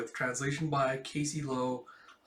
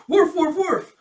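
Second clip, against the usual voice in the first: high, loud joyful woofs; happy barking; 11 dB louder, slower than usual. Acted. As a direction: bark like a delighted dog. Bond wagging his tail.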